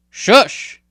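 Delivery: sharp loud commanding hush; ordered shush. Acted. Hyaktu silencing Jabali on deck, sharp shush. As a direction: cut him off with a firm shush. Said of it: "Hyaktu ordered".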